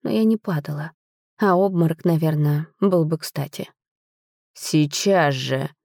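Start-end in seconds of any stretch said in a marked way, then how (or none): 4.5-5.7 slow, drawn-out hissing command; hissed order. A narrator's reading. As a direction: hiss it slowly through clenched teeth. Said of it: "прошипел мой муж".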